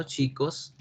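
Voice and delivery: normal talking voice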